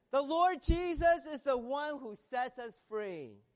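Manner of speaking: ringing pulpit proclamation; full-force preaching. Real, not acted. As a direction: proclaim it high and strong.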